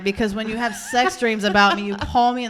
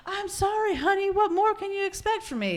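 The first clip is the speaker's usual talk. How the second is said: much higher, hammed-up apology; put-on pleading spouse; 10 semitones higher than usual. Spouse answering in a fifties domestic row, high mock pleading. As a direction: plead pitifully in a sitcom couple's quarrel. Acting out fifties couple's quarrel.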